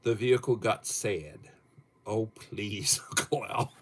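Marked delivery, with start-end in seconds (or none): none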